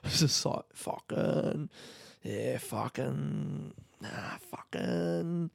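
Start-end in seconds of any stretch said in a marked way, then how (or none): 0.7-1.7 whispered Aussie swear, tossed off; an Aussie bloke muttering fucking to himself; Australian bloke swearing. Pretend. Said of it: "doing a voice of an Australian man swearing". 2.2-3.7 slow, soft Aussie fucking, stretched out; drawled Australian swear. Pretend. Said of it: "doing a voice of an Australian man swearing". 4.0-5.5 slow, soft Aussie fucking pitched higher; higher Australian swear. Pretend. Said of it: "doing a voice of an Australian man swearing".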